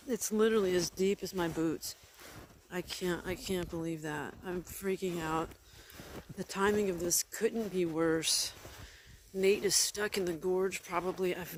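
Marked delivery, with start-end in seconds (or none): none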